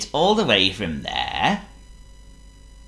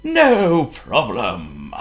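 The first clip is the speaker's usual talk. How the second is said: very slow, easy wizard; slow, mild smiling wizard; 4 semitones higher, 4 dB louder, much slower than usual. Acted. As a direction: reassure him with a smile. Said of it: "smiled the wizard".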